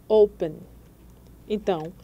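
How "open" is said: "Open" punched on the first syllable, O; stress on first syllable. Shown as a demonstration. Teacher showing learners the tonic syllable, "Open" stressed on O. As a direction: say "Open" loud on O, light on -pen. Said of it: "Veja a sílaba tônica qual é".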